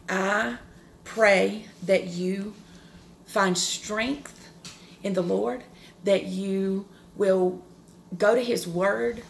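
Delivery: usual speaking voice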